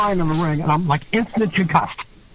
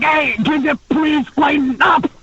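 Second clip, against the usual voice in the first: higher, slow mock plea; pleading complaint; 10 semitones higher than usual. Beaten wrestler begging his opponent to lighten up, high and slow. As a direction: beg him to ease off, half laughing. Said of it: "I'm like".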